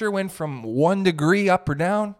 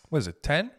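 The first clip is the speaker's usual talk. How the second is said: ordinary talk, nothing marked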